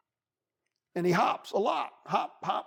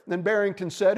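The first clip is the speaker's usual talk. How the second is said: plain reading voice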